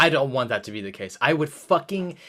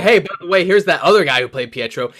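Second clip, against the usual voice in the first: mock studio voice; 3 semitones higher, 7 dB louder, faster than usual. Fan mocking the studio's imagined taunt, louder sarcastic jeer. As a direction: sneer it like a smug studio trolling fans. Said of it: "and then they say".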